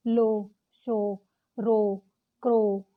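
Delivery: "लो", "शो" said very slowly with a long oh; sounding out oh words. Shown as a demonstration. Teacher slowly models ow spelt oh words. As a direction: draw out every oh. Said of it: "like देखिए".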